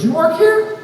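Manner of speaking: put-on old man grabs his arm on the stairs, frightened squeak; scared old man. A hint of a shaky old man, shot up high. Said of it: "and he goes".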